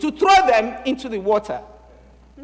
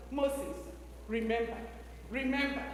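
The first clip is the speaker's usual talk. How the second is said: soft motherly refrain; 13 dB quieter than usual. Acted. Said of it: "Every day she sang the same thing".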